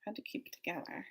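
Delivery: "Had to keep it together" whispered fast and very soft. Shown as a demonstration. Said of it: "gonna show you how I".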